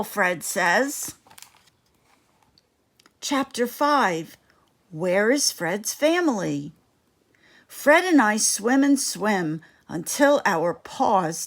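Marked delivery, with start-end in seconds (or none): none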